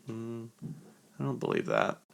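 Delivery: soft doubtful hum, then disbelief; politely unconvinced, under the breath at first; skeptical. Retold or imitated. Answering praise of a humble friend, skeptical.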